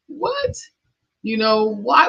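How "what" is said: a much higher squeaked What?, pitch shooting up; stunned what. Retold or imitated. Recalling her family's reaction, an incredulous What?. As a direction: ask What? as if it makes no sense at all. Disbelief at being told fine.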